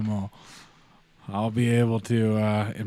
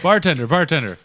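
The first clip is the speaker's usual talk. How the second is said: calling out; 6 semitones higher, 10 dB louder than usual. Acted. Calling out to bartender.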